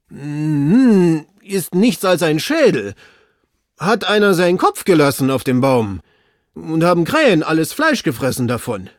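long puzzled hum, then high, loud report; servant reporting down. Acted. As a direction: call the odd find down from the tree.

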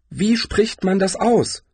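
slow, high-pitched puzzled question; puzzled woman's high voice; confused. A narrator's reading. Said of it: "fragte sie verwirrt".

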